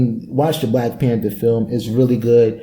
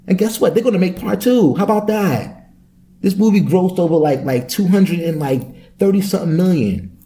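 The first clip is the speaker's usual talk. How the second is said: excited rant; 6 semitones higher than usual. Real, not acted. Ranting about the movie's success.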